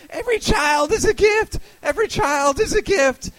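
high, loud mock rally chant; mock protester screaming. Comic imitating screaming protesters at an abortion rally, shrill mock chant. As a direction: screech it like a crowd of zealots. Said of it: "people screaming about abortion where they're like".

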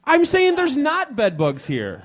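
usual speaking voice

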